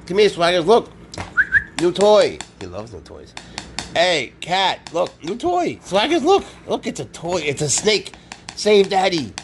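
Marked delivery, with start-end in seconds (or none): 0.1-2.4 higher, louder call to the cat; high coaxing call. Real, not acted. Owner calling the cat Swaggers to the toy, high excited coax. 3.9-9.3 excited pet talk. Real, not acted. Owner luring the cat to a toy snake, high excited baby talk.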